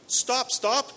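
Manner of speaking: high, loud cry of stop; frog crying out. Acted. Frog calling after the princess, high loud cry. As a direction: call after the fleeing girl. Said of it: "cried the frog".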